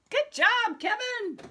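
gushing teacher praise; excited praise. Real, not acted. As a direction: cheer him on with a big lift. Praising child, second bigger take.

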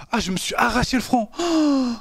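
high wincing moans; sore, ow-ow-ow complaint; pained groaning. Real, not acted. Just hurt own forehead.

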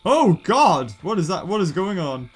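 ordinary talk, nothing marked

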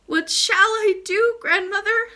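high, loud, slow wail; acted distressed child's cry; sobbing princess. A narrator's reading. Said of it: "sobbed the princess".

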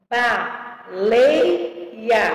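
"Baleia" in three spaced beats, ba-lei-a; syllable by syllable. Shown as a demonstration. Teacher separating words for pupils, syllable by syllable. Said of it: "separação de palavras e sílabas".

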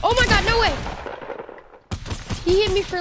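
shouts it high and loud in disbelief; excited outburst. Real, not acted. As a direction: yelp of surprise at the hit.

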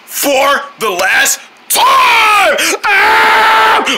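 slow, rising to a high scream; play-acted rage. Owner warning for the last time, screamed rage. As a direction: drag it out, then explode.

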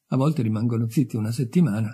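ordinary delivery

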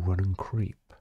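ordinary talk

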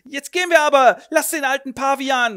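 high, loud scornful exit; a furious woman leaving; indignant outburst. Acted. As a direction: storm out with contempt. Woman storming out.

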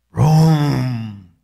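a huge booming roar of thunder; thunderous rumble. Retold or imitated. Preacher booming like the thunderous voice of God. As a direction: boom it out like God's voice. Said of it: "una voz como un estruendo".

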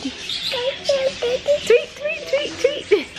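very high playful tweeting; bird tweets. Pretend. Mother tweeting like the macaw for her toddler, high. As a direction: chirp like a bird for the baby.

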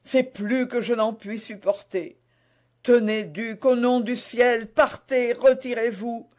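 high, agitated entreaty; pleading queen. Acted. Overwhelmed queen begs duke to leave. (supplication angoissée, voix aiguë)

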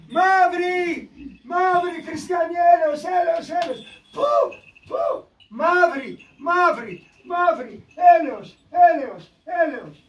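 high, drawn-out wailing shouts, retold; imitated deathbed cries. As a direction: cry for mercy over and over, as the dying person did. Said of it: "Και φώναζε".

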